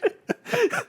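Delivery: high squealing laugh. Real, not acted. Laughing hard while telling his friend the prank. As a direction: break into a high giggle.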